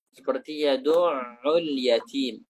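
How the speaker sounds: yadu'ul yatim with the ain stressed and held, slow; ain with tasydid. Shown as a demonstration. Teacher demonstrating the ain to a student, slow and careful. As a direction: ustaz demonstrating yadu'ul: ain pressed and lengthened. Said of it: "ain itu ditasdidkan".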